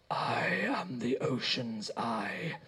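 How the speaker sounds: slow, eerie voice from an unmoving mouth; ringing creature voice. A narrator's reading. The Ocean's Eye announcing itself to Rob, slow and eerie. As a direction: sound like a voice ringing from a throat. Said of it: "the voice ringing through the throat".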